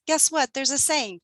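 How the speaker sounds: usual speaking voice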